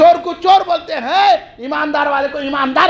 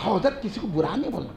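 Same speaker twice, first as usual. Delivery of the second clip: much softer, lower mock-timid voice; meek imitation; 6 semitones lower, 12 dB quieter than usual. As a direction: imitate a timid follower, hushed. Imitates followers repeating the saying.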